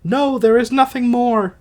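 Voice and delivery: high put-on wife's voice; Alcestis voice. Dying wife answering her husband, high put-on voice. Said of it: "I'll try to do voices".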